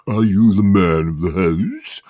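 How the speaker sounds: slow mocking English accent. Lad mocking the census lady, English accent. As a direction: parrot the question in a put-on English accent. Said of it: "putting on an English accent and repeating the question".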